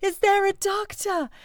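high, slow, drawn-out call for a doctor; hammed-up urgent cry down the train; calling out for help. Running through train carriages asking for a doctor, high drawn-out call.

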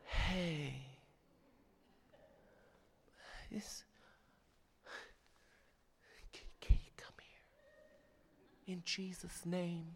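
soft, slow, breathy whisper; hushed, drawn-out mock plea; mock gentle whisper. Shown as a demonstration. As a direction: coax far too softly. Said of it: "you don't say to them".

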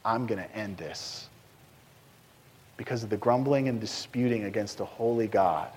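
quiet solemn verdict from God; God speaking. Retold or imitated. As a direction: say it low and heavy, as God passing judgment. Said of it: "He's like".

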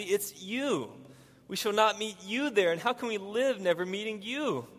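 put-on high, distressed child's plea, no real sobbing; acted tearful plea. A narrator's reading. Lucy cannot bear never meeting Aslan, in acted distress. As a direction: pitch it up into an anguished question. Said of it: "sobbed Lucy".

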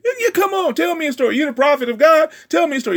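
high-pitched, eager king asking for a story; eager David. Acted. David urges Nathan to speak.

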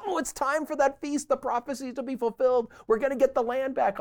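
high, loud and eager; hyped-up imitation of hopeful listeners; eager crowd. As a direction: play the crowd sure the prophecy is here. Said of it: "listeners expecting the feast".

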